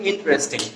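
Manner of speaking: ইন্টারেস্টিং squeezed to "in-tresting"; correct pronunciation. Shown as a demonstration. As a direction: drop the vowel after t in ইন্টারেস্টিং. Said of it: "উচ্চারণটা হবে কি". (শুদ্ধ উচ্চারণে তিন সিলেবলে ইন্টারেস্টিং)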